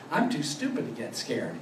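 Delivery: ordinary talk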